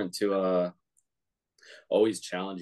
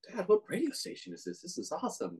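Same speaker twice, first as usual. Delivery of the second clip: put-on excited kid voice; 12 semitones higher, 4 dB quieter than usual. Kid asking dad in car.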